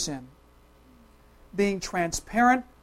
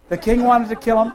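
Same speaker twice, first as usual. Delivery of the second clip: ordinary talk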